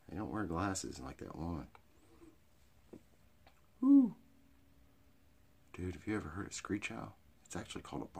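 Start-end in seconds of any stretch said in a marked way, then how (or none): 3.8-4.1 high, loud whooping cry. Real, not acted. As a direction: let out a shrill woo. Whoops after owl talk.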